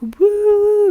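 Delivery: high, loud whoop; whooping for the clocks coming back, high and loud; excited cheer. Real, not acted.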